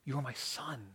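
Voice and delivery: very soft closing echo; hushed, tender. Acted. Voice from heaven to the beloved child, hushed. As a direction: say it gently, barely voiced.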